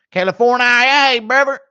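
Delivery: high, loud mock hype call; hyped shout. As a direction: yell it like a hype man.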